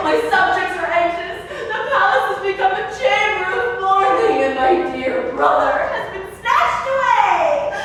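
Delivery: ordinary delivery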